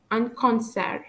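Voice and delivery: French "concert" with the final T silent. Shown as a demonstration. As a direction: end "concert" on the R, no T. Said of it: "I don't pronounce the letters D and T".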